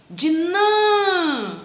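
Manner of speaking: Dinã stretched, NÃ sung high and loud; teacher calling out the stressed NÃ. Shown as a demonstration. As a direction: lift NÃ high to show the stress. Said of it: "é no nã".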